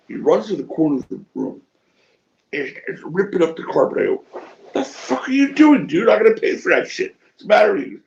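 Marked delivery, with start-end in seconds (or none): 4.3-8.0 much higher, exasperated mock yelling; acted outraged outburst. Teller scolding a friend for ripping up carpet, mock-outraged. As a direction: act out an incredulous telling-off.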